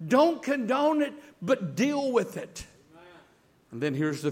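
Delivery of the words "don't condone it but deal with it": loud, high preaching; forceful preaching. Acted. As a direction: preach it hard to the church.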